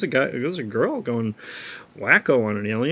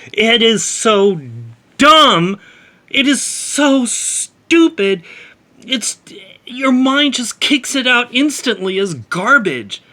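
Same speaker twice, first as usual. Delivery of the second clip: higher, louder, slow disgusted ranting; exasperated rant; 7 semitones higher, 8 dB louder than usual. Real, not acted. Reviewer blasting a comic's bribe scene, loud exasperated rant. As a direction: vent real disgust at a stupid plot, building loud.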